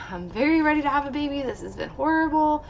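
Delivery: high, quick retold exclamation; emphatic readiness. Patient retells telling doctor she's ready for baby, emphatic. Acted eager answer to doctor.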